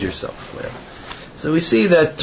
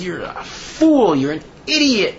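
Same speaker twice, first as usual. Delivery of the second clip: mild mock yell, pitched up; retold screaming; 5 semitones higher than usual. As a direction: imitate a man cursing someone out, without real volume. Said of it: "started screaming at the, the Gabbai".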